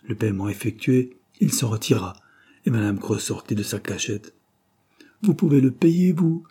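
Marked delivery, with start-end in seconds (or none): 5.2-6.4 high, loud, incredulous question; envious exclamation. A narrator's reading. Debtor to neighbour who can pay rent, envious exclamation. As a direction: exclaim with envy, pitch way up. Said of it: "s'écria-t-elle avec envie".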